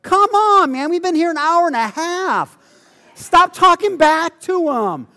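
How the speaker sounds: high, loud pleading complaint; exasperated. Retold or imitated. Bored teen pleading with the crowd, high and loud and exasperated. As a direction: whine loudly that it's been too long. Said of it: "I'm like".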